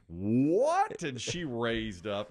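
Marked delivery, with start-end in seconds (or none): 0.1-1.0 indignant what. Retold or imitated. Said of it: "and she goes".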